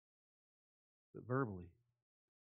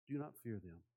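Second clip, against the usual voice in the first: ordinary talk, nothing marked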